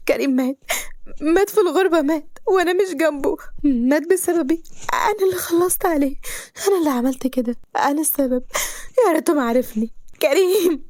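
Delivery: high, sobbing cries; breaking down. Acted. Girl tells her parents her love died, sobbing breakdown. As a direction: fall apart on every word. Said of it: "بنين بانهيار".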